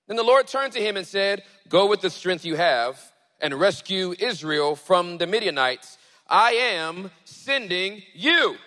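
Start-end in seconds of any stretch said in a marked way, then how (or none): 1.7-8.6 raised, emphatic divine charge, slowing at the end; the Lord's command. Acted. The Lord commissioning Gideon, raised commanding voice. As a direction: send him out with loud authority.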